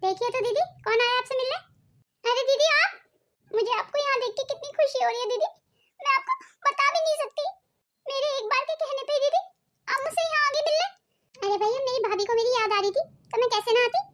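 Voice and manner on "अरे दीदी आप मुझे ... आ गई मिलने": higher, tearful voice of happy relief; sister-in-law greets her visitor, tearful with joy. Acted. Crying with happiness at the surprise visit. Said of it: "आप ऐसे रो क्यों रही हैं?".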